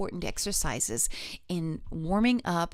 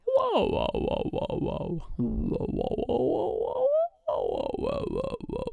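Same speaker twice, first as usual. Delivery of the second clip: whoa siren; 3 dB quieter, slower than usual. Shown as a demonstration. Voice teacher leading a whoa slide warm-up. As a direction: sweep down a big range on each whoa. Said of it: "Here we go".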